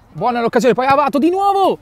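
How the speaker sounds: high rising excitement; excited call. Real, not acted. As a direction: build up as the chance comes. (telecronaca concitata)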